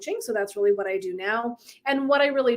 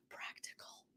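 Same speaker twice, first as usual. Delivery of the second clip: breathy whisper of one word; whispered aside; 28 dB quieter, slower than usual. Retold or imitated. Teasing listeners about her practical husband, whispered. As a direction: whisper it like a secret.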